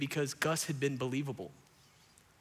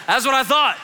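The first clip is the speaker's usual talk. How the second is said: raised, high mock exclamation; loud mock retort; 9 semitones higher, 15 dB louder than usual. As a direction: belt it out as a hammed-up retort. Mocking an acted quote.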